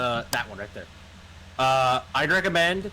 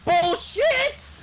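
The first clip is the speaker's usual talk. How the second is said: very high, squeaky disbelief; disbelieving outburst. Real, not acted. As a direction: yelp it in disbelief. Friend calls out disbelief.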